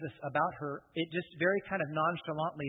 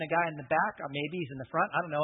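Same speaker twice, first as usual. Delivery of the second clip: usual speaking voice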